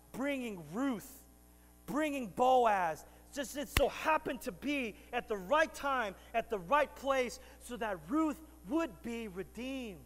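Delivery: loud, slow, rising sermon cadence; preaching at full force. Real, not acted. Preacher proclaims God's timing to the church, full force. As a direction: drive the point home to the congregation.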